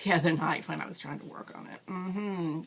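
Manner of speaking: normal talking voice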